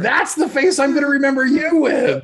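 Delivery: high, loud laughing voice; cracking up mid-sentence. Real, not acted. Laughing at friend's face.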